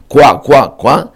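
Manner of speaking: repeated "kwa" croaks, punched; rhythmic frog-croak imitation; imitating frogs. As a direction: quack out frog calls. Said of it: "איך מחקית הספרדעים".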